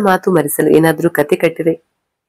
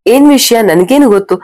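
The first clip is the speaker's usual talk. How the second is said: higher, louder echo of the question; irritated mocking echo; 6 semitones higher, 3 dB louder than usual. A narrator's reading. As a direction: throw the question back, mildly indignant. Wife sarcastically echoes his question inwardly. (ಅಣಕಿಸುವ ಸಿಡುಕಿನ ಧ್ವನಿ)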